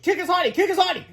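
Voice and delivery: high-pitched egging on; excited cry. A narrator's reading. Boy eggs on superhero, crying out high. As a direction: cheer it on in a high yell. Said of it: "cried George".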